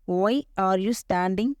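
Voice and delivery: "Why ... are you ... standing", spaced out; slow it down for learners to follow; slow teaching repeat. Shown as a demonstration. Teacher modelling a question for learners, slowly with gaps.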